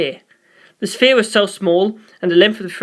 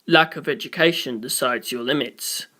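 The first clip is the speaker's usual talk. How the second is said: weary sigh; 6 semitones lower, 6 dB quieter than usual. A narrator's reading. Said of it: "Poochie sighed".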